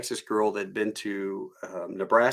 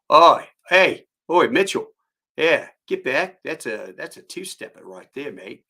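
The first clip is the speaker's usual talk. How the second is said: mock Australian; 5 semitones higher, 7 dB louder than usual. Cousin warning about a snake in the pool, mock Australian. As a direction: imitate an Aussie calling out, then casually warning. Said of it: "he comes out and he goes".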